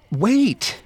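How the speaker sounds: high, loud warning cry; urgent shout. A narrator's reading. Victoria yells to the girl at the roof's edge, shouting. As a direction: shout it out to stop a child in danger. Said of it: "she shouted".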